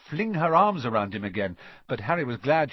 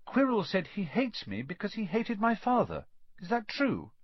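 put-on higher, earnest boy asking; a hint of a questioning boy; lightly voiced Harry; 10 semitones higher than usual. A narrator's reading. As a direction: ask it as a troubled young pupil.

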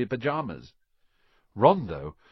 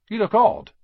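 blunt remark; 9 semitones higher, 10 dB louder than usual. Acted. Ron remarks on Harry.

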